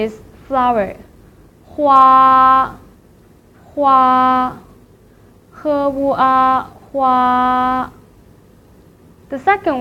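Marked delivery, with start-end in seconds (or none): 1.7-7.9 "hua" held long and level, broken down slowly as "he wu a"; first tone. Shown as a demonstration. Teacher drills the word for flower, long level "hua". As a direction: sing "hua" out on one steady note. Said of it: "here are some vocabularies".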